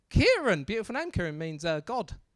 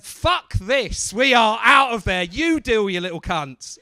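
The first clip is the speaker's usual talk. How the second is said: fed-up teachers; 7 dB louder than usual. Retold or imitated. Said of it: "teachers went".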